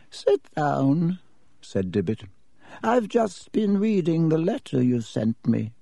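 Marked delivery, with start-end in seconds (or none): 0.1-1.2 put-on old headmaster voice. A narrator's reading. Headmaster Dippet speaks. 2.8-5.7 put-on old headmaster voice. A narrator's reading. Headmaster Dippet speaks.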